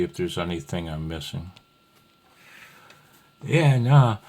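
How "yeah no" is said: bursts out loud and high; a loud, high yeah-no; loud sudden interjection. Real, not acted. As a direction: blurt it, loud and pitched up. Sudden outburst after pause.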